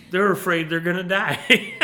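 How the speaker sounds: loud and high, breaking into laughter; amused disbelief. Real, not acted. Preacher marvelling at the people's fear, amused disbelief. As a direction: exclaim it as laughter takes over.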